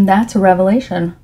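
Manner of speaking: normal talking voice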